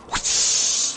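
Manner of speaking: airy whoosh sound; imitated swoosh of a leap; whoosh noise. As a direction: blow out a rushing whoosh. Mimicking a jump out window.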